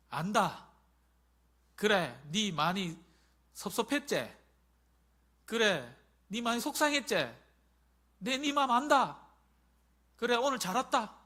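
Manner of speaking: loud, high tender reassurance; God consoling His child, voice lifted; comforting father. Acted.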